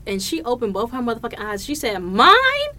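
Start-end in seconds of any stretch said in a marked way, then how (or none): 2.0-2.7 high, loud incredulous imitation; mock indignation. Imitating the partner answering an awkward question, mock indignation. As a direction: throw it back as an affronted partner, hammed up.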